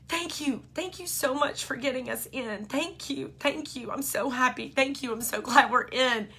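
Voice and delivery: imitating a tearful child, high-pitched; tearful gratitude. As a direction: overcome little boy pouring out thanks. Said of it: "like, tears, kinda like this".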